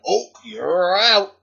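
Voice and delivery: put-on spring the trap on the kid; mock gotcha. Teacher catching a kid out in Simon Says, a drawn-out mock gotcha.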